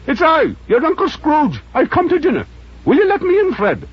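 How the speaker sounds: put-on high, thin elderly voice; old Scrooge voice. Scrooge begging the charity gentleman, high old voice.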